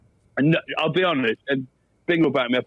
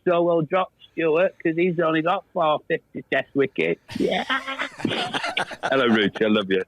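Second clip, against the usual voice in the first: Geoffrey Boycott impression; 3 semitones higher than usual. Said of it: "Jeffrey Boycott make of that situation".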